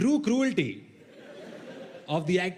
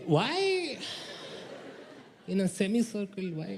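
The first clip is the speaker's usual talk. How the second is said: incredulous why; 4 dB quieter than usual. Real, not acted. Incredulous about chairs.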